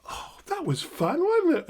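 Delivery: high, light, pleased reply; delighted client. Retold or imitated. Client opening their eyes after the exercise, mock delighted. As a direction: pitch it up high like a tickled client.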